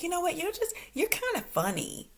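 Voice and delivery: high, soft, skeptical side-eye delivery; side-eye funny. Retold or imitated. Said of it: "kinda like that side-eye funny".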